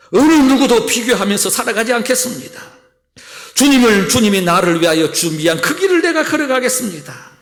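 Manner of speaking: loud, high pulpit declaration; a pastor crying out vows before the congregation, loud and high; fervent preaching. Acted. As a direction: proclaim each vow at full force. Preaching vow prayer.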